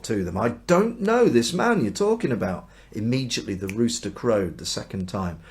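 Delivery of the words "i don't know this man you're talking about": swearing denial. Acted. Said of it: "call down curses, and he swore".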